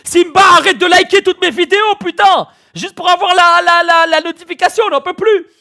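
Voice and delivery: loud, high, fed up; exasperated shout. Acted. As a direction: yell at the spammer. (cri exaspéré)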